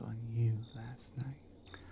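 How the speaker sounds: slow suggestive whisper. Acted. Orc captor teasing the prisoner about last night, whispered.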